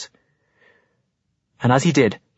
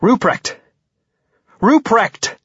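calling out; 10 semitones higher, much slower than usual. Acted. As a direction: call him to stop him. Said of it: "he calls".

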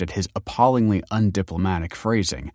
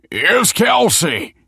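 loud, high, drawn-out announcement; yell; 13 semitones higher, 7 dB louder, much slower than usual. A narrator's reading. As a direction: bellow it to someone hard of hearing. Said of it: "yelled Tony".

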